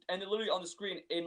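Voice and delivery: ordinary talk, nothing marked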